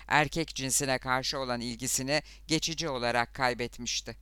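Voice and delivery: plain reading voice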